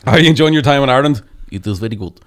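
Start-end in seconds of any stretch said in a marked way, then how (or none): 1.5-2.2 mock Russian accent. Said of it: "I couldn't do a Russian accent".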